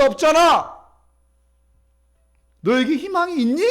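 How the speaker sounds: scornful jeering that swings up high; rival's taunt. Acted. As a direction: jab at her as a spiteful rival. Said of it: "분인나가 한나에게 이렇게 했을 거예요".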